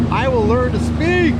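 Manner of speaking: high, slow, loud proclamation; mock grand declaration. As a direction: announce it grandly to mock yourself. Self-mocking after fumbling words.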